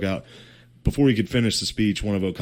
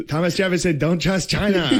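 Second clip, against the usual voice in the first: laughing, hammed-up Irish accent; mock Irish accent; 10 semitones higher than usual. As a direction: ham up a thick Irish accent. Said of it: "in that fucking Irish accent".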